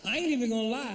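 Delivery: ordinary talk, nothing marked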